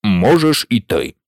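sending the witness away; low, offhand order; low bored king. A narrator's reading. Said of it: "сказав король".

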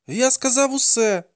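put-on high-pitched boy's protest; insisting boy. A narrator's reading. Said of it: "запевняв хлопець".

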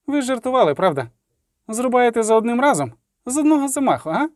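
high, nervous poet; anxious questioning. A narrator's reading. Poet asking executioner for one clean stroke, anxiously high. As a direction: plead for reassurance in a high voice.

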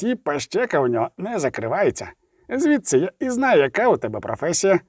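chatty, good-humoured dwarf; amused dwarf. Acted. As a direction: explain with a little smile, teasing the witcher. Zoltan answers with a slight smile.

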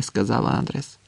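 ordinary delivery

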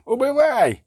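high-pitched drawn-out yell; yelled battle cry. A narrator's reading. Bandit charging at the witcher, high yell. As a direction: yell it high, stretching the last vowel. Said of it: "зарепетував він, кидаючись на Геральта".

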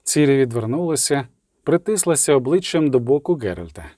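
usual speaking voice

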